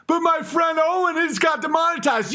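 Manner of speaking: high shouted whine; whiny protest. Retold or imitated. The bear protesting to the boss, high shouted whine.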